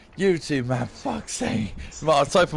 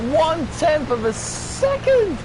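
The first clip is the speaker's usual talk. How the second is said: much higher and slow, savouring each word; amazed; 11 semitones higher than usual. Real, not acted. Commentator marvelling at the razor-thin gap, amazed and slowed. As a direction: stretch it out in disbelief.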